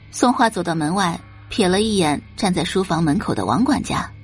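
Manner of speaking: usual speaking voice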